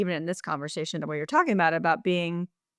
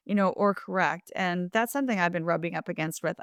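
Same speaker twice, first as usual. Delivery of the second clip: ordinary talk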